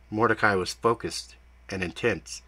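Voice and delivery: ordinary delivery, nothing marked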